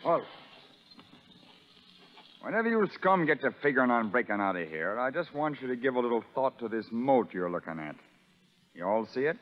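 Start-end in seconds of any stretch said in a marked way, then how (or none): none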